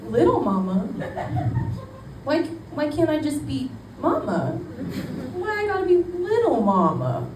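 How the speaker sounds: rising, whiny protest; mock offended. Complaining to the catcaller, mock offended whine. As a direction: sound wounded for comic effect.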